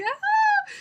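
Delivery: very high short yelp of fright; a sudden squeal as it crawls on you; high startled scream; retold shriek. Scorpion crawling on arm.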